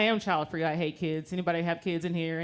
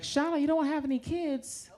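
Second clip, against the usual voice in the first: high, softer questioning voice; incredulous patient; 7 semitones higher, 5 dB quieter than usual. Retold or imitated. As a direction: imitate a patient's disbelief, pitched up. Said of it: "They're always like".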